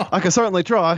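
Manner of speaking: high-pitched South African voice through laughter; South African accent. Acted. Co-host asked for the rest of the show, laughing South African accent. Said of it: "in a South African accent".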